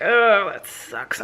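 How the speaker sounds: slow, deflated "oh, that sucks"; a slow sigh of mock disappointment; mock dismay. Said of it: "So I'm like".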